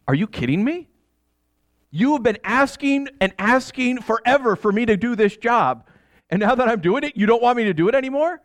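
rising, exasperated pushback at his wife; indignant protest. Acted. Husband argues with wife.

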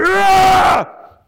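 high crazed shout of excitement; manic yell. Retold or imitated. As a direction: let out a wild surgeon's yell. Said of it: "And the surgeon is like".

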